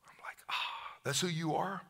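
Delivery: hushed, breathless wonder; awed whisper. Retold or imitated. Preacher realizing who Jesus is, awed whisper. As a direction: whisper it in awe.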